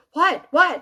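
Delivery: high, loud, quick "what"; retold startled question. Construction guys reacting to her outburst, startled and loud. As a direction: blurt it like startled coworkers. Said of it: "the guys at work are going".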